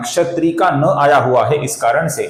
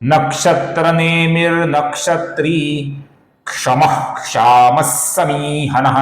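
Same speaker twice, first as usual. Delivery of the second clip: slow measured verse chant; slow Sanskrit shloka chant; shloka recitation; slower than usual. Shown as a demonstration. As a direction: recite slowly, syllable by syllable. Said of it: "फिर से एक बार देखिए".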